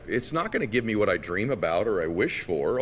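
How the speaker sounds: ordinary talk, nothing marked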